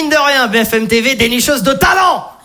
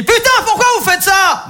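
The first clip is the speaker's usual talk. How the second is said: higher, shouting questions; angry barber; 10 semitones higher than usual. Retold or imitated. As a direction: grill the customer in a rage. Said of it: "il me dit". (coiffeur énervé qui crie)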